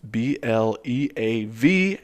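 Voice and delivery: ordinary delivery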